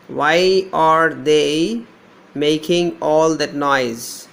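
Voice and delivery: very slow, stretching out each word; slow dictation. Shown as a demonstration. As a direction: draw the words out for students to hear. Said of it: "I is there".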